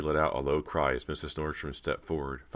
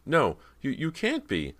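high, panicked disbelief; terrified; 11 semitones higher, 4 dB louder than usual. A narrator's reading. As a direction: cry out in terror.

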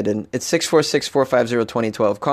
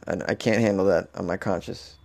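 ordinary talk

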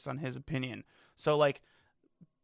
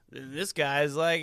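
usual speaking voice

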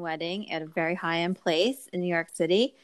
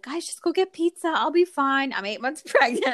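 brave reassurance; 8 semitones higher than usual. Retold or imitated. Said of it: "And I was like".

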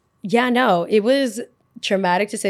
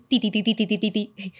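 call button beeps. Retold or imitated. Imitating the nurse call button.

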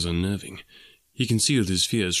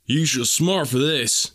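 slow, louder and higher in rage; cried in rage; 5 semitones higher, 4 dB louder, slower than usual. A narrator's reading. Suitor threatening the farmer as he is thrown out, slow, louder and higher in rage. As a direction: spit out the threat in fury.

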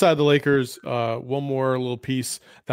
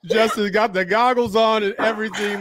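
higher voice breaking up with real laughter; laughing; 8 semitones higher than usual. Real, not acted. Host introducing his buddy who just popped into the chat, laughing. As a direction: try to get the introduction out through laughter. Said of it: "I'm sorry, I'm laughing".

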